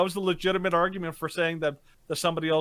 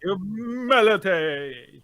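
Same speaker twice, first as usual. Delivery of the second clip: drawn-out mock solemn; much slower than usual.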